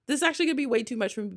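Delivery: tumbling out in a rush, half-panicked; rushed overwhelmed. Retold or imitated. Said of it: "I was like".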